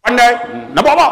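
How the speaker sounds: high, loud irritated voice; snappy clerk. Retold or imitated. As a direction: snap at the customer. Acted clerk snapping at customer.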